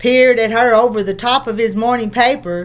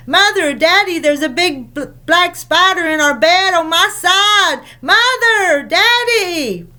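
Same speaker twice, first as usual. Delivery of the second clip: high, panicky cry for mother and daddy; frightened child calling; 6 semitones higher than usual. Acted. Anne running to her parents' room, scared of a spider. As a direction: call out in a fright.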